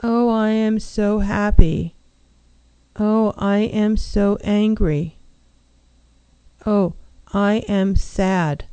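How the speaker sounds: slow, flat emotion words with no life behind them; flat, no energy. Shown as a demonstration. Counselor showing a radio audience how emotions do not sound, slow and flat. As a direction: say the feelings slowly and emptily, as if reading a list. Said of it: "No, that's not how emotions go".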